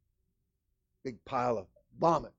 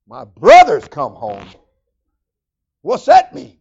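loud astonished question; 21 dB louder, slower than usual. Acted. Preacher voices the astonished son hearing news. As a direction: throw the question out in disbelief. Son asks about the party.

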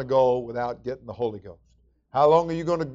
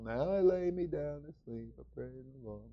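rote bedtime prayer; 11 dB quieter than usual. Retold or imitated. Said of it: "some lullaby".

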